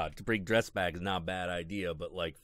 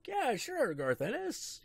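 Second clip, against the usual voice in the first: high mock agreement; slower than usual. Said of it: "you'd be like".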